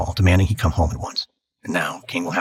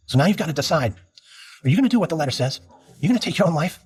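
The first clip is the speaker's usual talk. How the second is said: higher and higher with each question; wife demanding he answer, pitch climbing higher; pressing questions; 9 semitones higher than usual. Acted. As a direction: push him harder, voice climbing. Wife confronts him about suicide letter.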